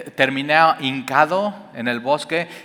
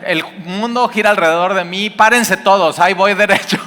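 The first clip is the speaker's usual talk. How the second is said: higher, louder mock of a brash teenager; cocky teen; 6 semitones higher, 5 dB louder than usual. Preacher mocking cocky teen drivers, higher and louder. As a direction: swagger it like a teen who owns the road. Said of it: "los jóvenes piensan que".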